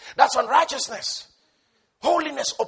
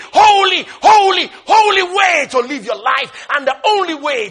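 loud, high, building shout; preaching at full force; 6 semitones higher, 9 dB louder than usual. Real, not acted. Preacher drives home love over law, loud and high. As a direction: preach it to the rafters.

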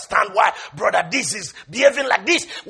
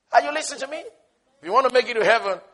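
ordinary talk, nothing marked

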